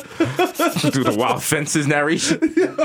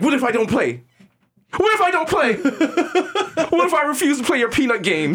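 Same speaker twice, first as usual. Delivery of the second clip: high desperate defiance; 11 semitones higher than usual. Retold or imitated. Mister Peanut defies the Nutcracker, high frantic pleading. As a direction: cry it out high, half laughing. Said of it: "He's like".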